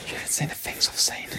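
unvoiced, hushed whisper; haunted whisper. Teasing his friend about the lost delivery, in a whisper. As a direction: whisper it like a shaken veteran.

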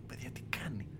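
retold stunned whisper to the lads; hushed disbelief. Fan to his friends as the striker runs through, hushed disbelief. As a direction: quote it in a soft, near-voiceless whisper. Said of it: "και λέω".

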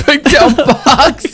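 high, stretched-out question through laughter; incredulous laughing squeal, drawn out; laughing disbelief. Real, not acted. Laughing disbelief at odd joke.

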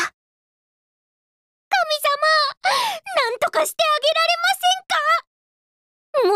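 high, earnest plea to the heavens; pleading prayer. Acted. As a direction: beg the gods dramatically. Plea to god for friend.

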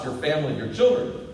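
ordinary delivery

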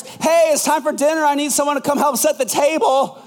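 put-on high call up to the kids about dinner; calling up the stairs. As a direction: call upstairs, cheerful but expecting nothing.

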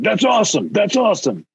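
high, pumped-up soundboard shout; hyped sample voice. Retold or imitated. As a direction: do a cheesy DJ drop sample. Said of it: "Be like".

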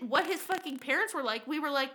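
usual speaking voice

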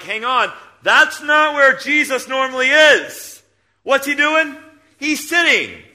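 a preacher reliving his surprise while reading Stephen; surprised. Retold or imitated. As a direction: stop short as if catching a detail. Preacher retells own surprise.

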